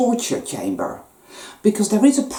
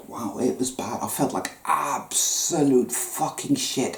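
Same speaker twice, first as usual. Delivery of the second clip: whisper; 5 semitones lower, 6 dB quieter than usual. Real, not acted. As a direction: breathe it out, barely voiced. Recalling feeling awful.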